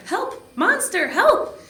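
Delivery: very high, loud panicked cry for help; terrified cry. A narrator's reading. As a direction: scream for help in fright. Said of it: "The professor cried".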